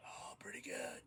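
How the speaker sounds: imitating a lost voice, hoarse whisper; voice gone. Sick student answering a nurse with no voice, imitated rasping whisper. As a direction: force out a voiceless, high croak of a reply. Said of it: "No voice".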